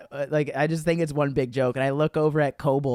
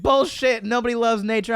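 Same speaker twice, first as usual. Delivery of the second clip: higher, louder mock barking; drill sergeant bark; 8 semitones higher, 5 dB louder than usual. Drill sergeant scolding the recruit.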